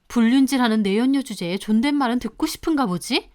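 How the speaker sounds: plain reading voice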